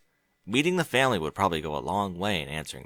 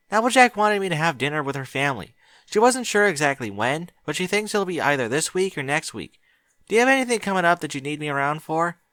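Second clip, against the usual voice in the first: put-on higher, eager young mare voice; Twilight's voice; 7 semitones higher, 4 dB louder than usual. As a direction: ask him kindly and brightly.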